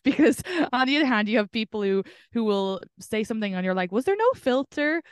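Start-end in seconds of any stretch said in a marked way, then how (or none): 3.9-5.0 higher, mildly appalled question; mock disbelief. Listener reacting to an unfiltered remark, mock disbelief. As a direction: ask it pitched up, imitating disbelief.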